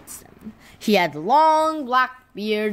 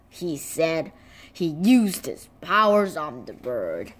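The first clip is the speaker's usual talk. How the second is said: plain reading voice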